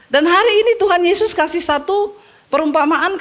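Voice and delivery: high, driving rebuke to the church; fervent preaching. Acted. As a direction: preach it hard, calling them out.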